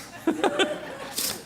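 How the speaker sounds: a high, airy giggle; high laugh. Retold or imitated. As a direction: laugh in disbelief. Amused laughter.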